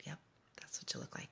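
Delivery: hushed confirming whisper. Retold or imitated. Mom quietly confirming to her son, in a whisper. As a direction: breathe the answer softly, almost no voice.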